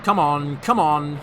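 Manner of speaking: loud, pushing puffs; puffing. A narrator's reading. Thomas pulling the trucks, puffing with effort. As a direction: chug it out with effort. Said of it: "he puffed".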